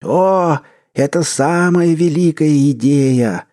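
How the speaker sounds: put-on slow, high manic old man; manic old usher. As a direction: rave about it with mania. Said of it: "немного маниакально".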